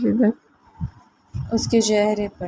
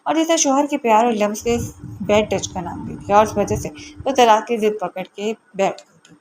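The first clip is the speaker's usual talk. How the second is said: plain reading voice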